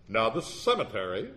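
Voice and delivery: mildly pompous lawyer intoning; lightly voiced, self-important formality; intoned. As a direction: intone it like a courtroom attorney. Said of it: "he intoned".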